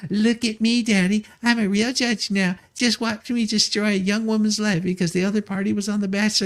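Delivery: high, whiny spoiled daughter showing off to daddy; mock a bratty girl begging for her father's praise; mocking Judge Matta. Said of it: "was Judge Matta simply trying to impress her father".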